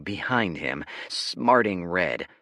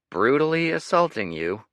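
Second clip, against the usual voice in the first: skeptical echo; 8 semitones higher, 6 dB louder than usual. Acted. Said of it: "quirking a brow".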